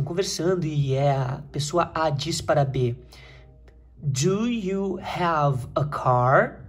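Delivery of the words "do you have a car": drawn out word by word; very slow model reading. Shown as a demonstration. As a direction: read it very slowly for learners. Professora lê a frase como modelo. (leitura modelo bem lenta)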